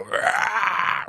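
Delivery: sharp high fake battle grunt; mock charging grunt. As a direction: bark it like you're about to hit. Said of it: "I let out this grunt like I'm just gonna hit him".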